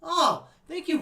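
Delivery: ordinary talk, nothing marked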